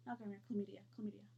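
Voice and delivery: hushed whispered correction. Real, not acted. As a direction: drop to a whisper.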